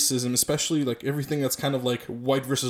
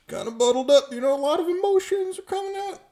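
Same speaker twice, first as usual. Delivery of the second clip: mock high voice; 14 semitones higher than usual. Mocking emotional outburst.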